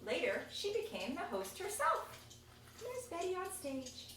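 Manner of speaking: ordinary talk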